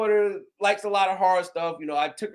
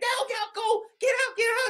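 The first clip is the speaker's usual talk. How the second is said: urgent mom. Retold or imitated. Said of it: "my mom was like".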